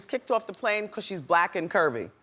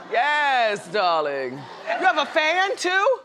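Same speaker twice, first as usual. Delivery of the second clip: playful darling; 3 semitones higher, much slower than usual. Real, not acted. Host flirts with audience member.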